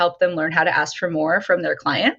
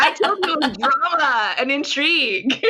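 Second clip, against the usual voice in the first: higher-pitched, laughing callback to a joke; real laughing; 8 semitones higher than usual. Real, not acted. Guest reminding host of the promised drama, laughing.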